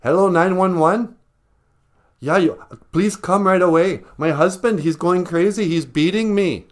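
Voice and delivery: high, loud panicked wife on the phone; a wife calling 911 in panic; frantic caller. Acted. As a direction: beg the dispatcher for help. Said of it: "Take four".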